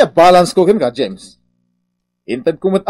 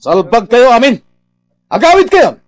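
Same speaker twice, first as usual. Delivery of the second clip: angry shout; 8 semitones higher, 6 dB louder than usual. Acted. Boss throws workers out.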